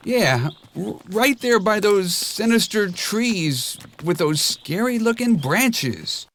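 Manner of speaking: high, stammering scared donkey; shivering donkey. A narrator's reading. Donkey spooked by the dark woods, stammering high. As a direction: stutter with a shiver of fear. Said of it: "Donkey paused to shiver".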